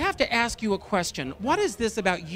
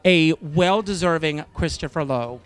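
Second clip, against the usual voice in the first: gushing red carpet chatter; 7 dB louder, slower than usual. Acted. Host introducing guest loudly.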